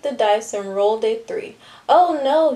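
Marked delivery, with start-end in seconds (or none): 1.9-2.5 higher, louder moan of dismay; a raised groan of dread. A narrator's reading. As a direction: moan it as bad news lands. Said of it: "he moaned".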